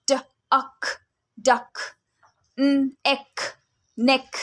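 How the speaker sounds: each sound split apart, then blended into the word. Shown as a demonstration. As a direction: sound out ख-ending words slowly for a child. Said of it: "जैसे कि".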